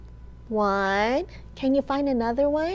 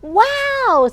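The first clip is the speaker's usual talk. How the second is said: much higher, louder squeal of wonder; owl's wow; 12 semitones higher, 7 dB louder than usual. Acted. Owl sees the yellow sun, a very high, loud wow. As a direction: sing the wow out in awe. Owl exclaims in picture book.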